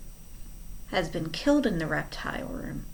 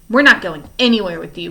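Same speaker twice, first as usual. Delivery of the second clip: louder, firm refusal; defiant; 9 dB louder than usual. Acted. Klaus defies Stefano, louder and firm. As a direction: plant your feet and refuse.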